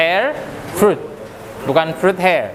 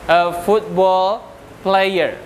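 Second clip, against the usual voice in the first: drawn-out model sentence, said very slowly; slow dictation; much slower than usual. Shown as a demonstration. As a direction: spell it out slowly for the class. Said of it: "Kalau saya dulu impiannya". (ucapkan sangat pelan seperti mendikte)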